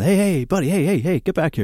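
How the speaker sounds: half-hearted yell after a shoplifter; retold yelling. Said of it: "we're yelling".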